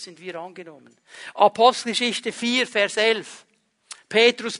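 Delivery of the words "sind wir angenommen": hushed refrain. Shown as a demonstration. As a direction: drop to a near whisper on "sind wir angenommen". Refrain repeated, dropped to near hush.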